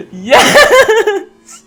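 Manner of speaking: high, loud yes through laughter; laughing triumphant yell. Real, not acted. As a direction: let the joy burst out again. Laughing after winning.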